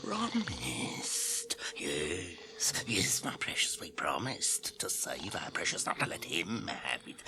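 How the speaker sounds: hissing, whispering creature arguing with itself; Gollum muttering to himself. Acted. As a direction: wheedle and hiss to yourself like Gollum. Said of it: "He's talking with himself".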